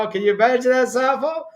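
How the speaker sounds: usual speaking voice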